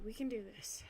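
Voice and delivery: quiet self-encouragement, breathed out; whispered pep talk. Real, not acted. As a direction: whisper it to yourself.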